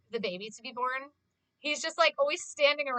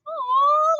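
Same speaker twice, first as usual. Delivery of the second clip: much higher, louder gushing aw; cooing aw; 12 dB louder than usual. Retold or imitated. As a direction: melt over something cute. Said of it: "And I'm like".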